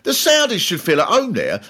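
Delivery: ordinary delivery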